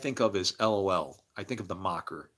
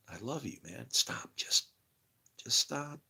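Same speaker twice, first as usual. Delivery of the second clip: whisper; 7 dB quieter than usual. Retold or imitated. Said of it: "And I'm going".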